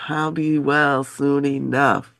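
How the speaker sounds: slow, soft, weary; weak and soft. Shown as a demonstration. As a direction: say it softly and slowly.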